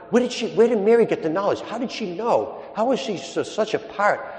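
high, urgent rhetorical questions; impassioned questions. Real, not acted. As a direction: press the questions, rising in wonder. Rising rhetorical questions about Mary.

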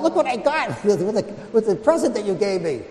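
high, laughing delight; excited bragging. Retold or imitated. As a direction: show off the prize, giggling. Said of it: "you're gonna tell them".

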